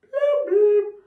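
loud alien blubs; shouting underwater. Shown as a demonstration. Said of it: "how do we say goodbye".

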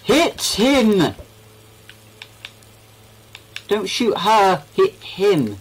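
high yelling command; loud shout. Real, not acted. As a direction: yell it at your teammate. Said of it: "you don't have to be so loud".

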